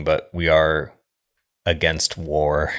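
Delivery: ordinary delivery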